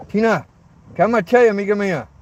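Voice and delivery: lifted, insistent dog-calming call; hushing the dog. Real, not acted. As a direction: call out high to settle your dog.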